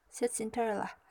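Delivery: plain reading voice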